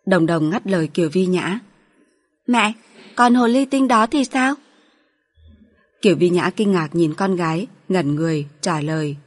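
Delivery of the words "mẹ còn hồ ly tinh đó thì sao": put-on higher, childlike voice, a touch sharp; a little girl asking her mother pointedly; young daughter. Little daughter asking mother about the mistress, in a raised childlike voice.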